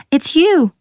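high, loud cry of astonishment; surprised cry. A narrator's reading. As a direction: exclaim it, pitch leaping right up. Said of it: "Ayla cried in surprise".

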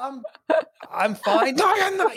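high, combative mock Scottish accent; exaggerated Scottish back-and-forth. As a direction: play a Scot picking a fight. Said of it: "seems like you're gonna get assaulted".

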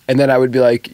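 usual speaking voice

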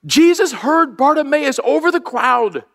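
high, slow, amazed exclamation; awed realization. Real, not acted. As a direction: savor the discovery, lifted and slow. Sudden realization while preparing.

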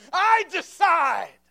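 high, slow full-force preacher's shout; commanding loud cry. Acted. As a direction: cry out each phrase with absolute authority, voice falling at the ends. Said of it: "And he cried out".

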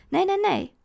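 loud, high burst of protest; panicked. A narrator's reading. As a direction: blurt it out in rising panic. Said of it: "er bruist een paniek in mijn borst".

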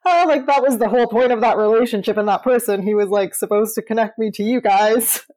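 joking. Retold or imitated. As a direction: toss it out fast as a running joke. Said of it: "joke with my shamans now".

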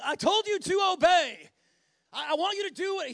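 loud, high mock-angry command; evil stern father. Preacher mimicking a distant father demanding obedience, angry shout. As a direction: holler it like a controlling father. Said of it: "like this evil sort of father standing back at a distance going".

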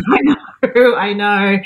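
laughs through the words, pleased; a real laugh breaking into the words. Real, not acted. Answering the host's "good daughter" with a laugh.

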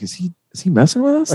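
imitating stunned students asking each other; incredulous classmates. Students doubting the teacher, in a high mock-baffled voice. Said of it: "everybody was looking at each other like".

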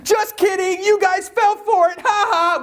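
very high, chirpy fake cheer; a boy hiding tears behind a squeaky cheer; fake cheerful kid. A boy pretending to his family he wasn't hurt, squeaky fake cheer. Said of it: "I just acted like I was crying wolf".

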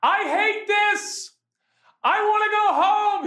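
retold yell. Dad yelling to his wife on the trail that he hates this. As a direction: whine it out like a worn-out kid at the top of your lungs. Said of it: "And I'm yelling".

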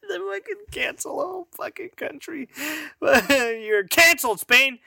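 high, laughing mock verdict on Spain; laughing mock cancel. Real, not acted. Laughing as he cancels a whole country. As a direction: giggle through the cancel call.

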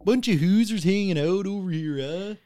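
high, exaggerated Canadian accent. Shown as a demonstration. Friend riffing on hosers, mock Canadian accent. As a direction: stretch the vowels, mock Canadian. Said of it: "you say the Canadian accent".

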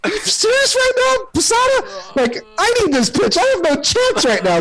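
high-pitched mock disbelief; incredulous protest. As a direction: plead your case in a high, exasperated voice. Batter pleads with catcher during at-bat.

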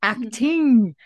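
loud, high theatrical flourish; hammed-up exclamation. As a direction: proclaim it like a mock thespian. Hammy exclamation praising the acting.

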